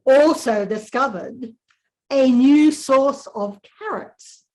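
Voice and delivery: deadpan diary read with long pauses; reading to children. A narrator's reading. Author reading her wombat book aloud to a class, dry and deliberate.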